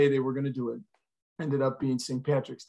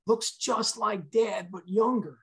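high, drawn-out insistence; stretched, higher-pitched retold urgency; agitated insistence; 7 semitones higher, slower than usual. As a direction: imitate an agitated son, slow and high. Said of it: "he was very agitated".

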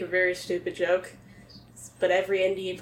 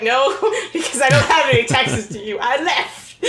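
laughing defiance; 6 semitones higher, 5 dB louder than usual. Retold or imitated. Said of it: "I'm like".

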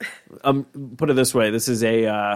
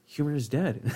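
deadpan despair; 8 dB quieter than usual. Retold or imitated. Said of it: "he saw my thing and goes".

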